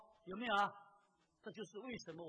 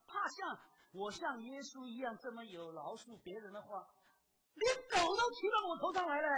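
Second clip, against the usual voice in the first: much higher and louder, pressing the point; full-force preaching; 9 semitones higher, 8 dB louder than usual. Acted. Preacher challenging believers who fear forgiving, impassioned preaching. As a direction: hammer it home from the pulpit.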